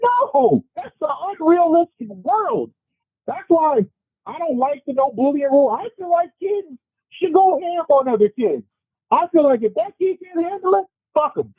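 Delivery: impassioned rant, voice way up; worked up. Real, not acted. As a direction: push every line up in pitch, heated. Heated rant against no-bullying rule.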